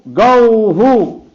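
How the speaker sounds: "गौहु" बहुत धीरे, खींचकर, गिरते सुर में; दूसरा "गौहु" धीमा और खिंचा, अंत में नीचे उतरता; गो का दूसरा उच्चारण. Shown as a demonstration. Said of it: "ऐसा बोला ना". ("गौहु" बहुत धीरे खींचकर, गिरते सुर में)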